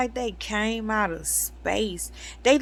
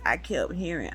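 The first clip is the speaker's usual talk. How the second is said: ordinary talk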